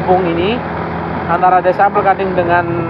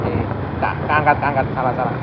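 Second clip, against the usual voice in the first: muttered aside; 6 semitones lower, 7 dB quieter than usual. Real, not acted. Mutters about camera mistake.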